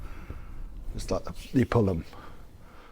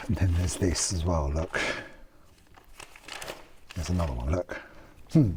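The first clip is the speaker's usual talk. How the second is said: usual speaking voice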